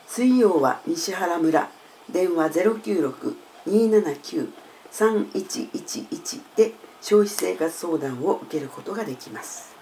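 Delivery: usual speaking voice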